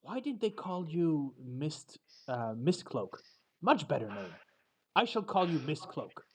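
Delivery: put-on a proud old dragon renaming someone; haughty dragon. Dragon judging the knight's armour, haughty and soft.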